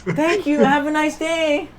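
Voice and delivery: high, drawn-out customer-service voice; shop-worker farewell. Acted. Shop worker thanks the customer for gold, high service voice.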